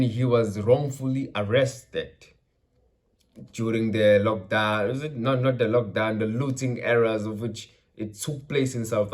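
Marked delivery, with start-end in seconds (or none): none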